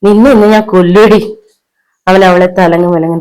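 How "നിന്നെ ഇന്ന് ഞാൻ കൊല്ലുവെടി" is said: loud, menacing outburst; furious threat. Acted. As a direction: threaten her in a raised, angry voice. Husband threatens and beats wife.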